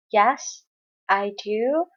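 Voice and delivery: normal talking voice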